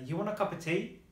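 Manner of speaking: "You want a cup of tea" lifted into a question; question intonation. Shown as a demonstration. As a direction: make it a question by tune alone. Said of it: "that's why we use that intonation".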